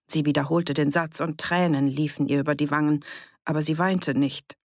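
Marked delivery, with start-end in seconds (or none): none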